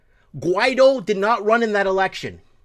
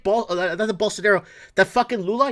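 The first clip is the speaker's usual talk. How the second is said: normal talking voice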